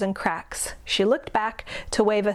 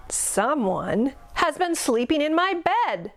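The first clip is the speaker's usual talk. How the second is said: mock bellow; 6 semitones higher than usual. A narrator's reading. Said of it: "Papa Bear bellowed".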